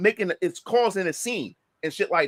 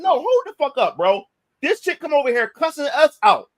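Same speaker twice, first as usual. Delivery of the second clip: higher, louder objection; retold heated protest; 5 semitones higher, 5 dB louder than usual. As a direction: cut in indignantly with raised voice.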